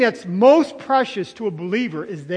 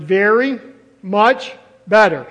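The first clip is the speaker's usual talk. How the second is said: "very", "much", "better" each punched separately; triple emphasis; 5 dB louder, slower than usual. Shown as a demonstration. Preacher showing three emphatic Greek words, "very much better" punched word by word. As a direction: hit "very", then "much", then "better", with gaps between. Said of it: "He wanted to triple emphasize it".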